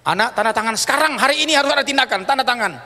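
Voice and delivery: urgent doctor. Retold or imitated. Doctor urging family to sign.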